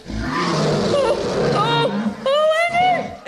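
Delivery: frightened. Acted. Dread as the monster approaches.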